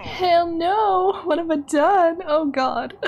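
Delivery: high, wailing dismay; panicked regret. Real, not acted. Player regretting the risky choice, high panicked wail. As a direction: cry out in rising panic.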